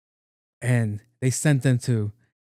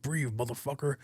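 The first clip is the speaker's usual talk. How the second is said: menacing hush; 2 semitones higher, 10 dB quieter than usual. Retold or imitated. Gang killer to a victim shoved into coke, softer, menacing hush. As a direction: lean in close and threaten under your breath.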